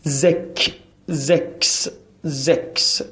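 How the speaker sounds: زکس built up, first زک then the full زکس; German six. Shown as a demonstration. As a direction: say زکس with a voiced z and a clear ks at the end. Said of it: "دقت کنید".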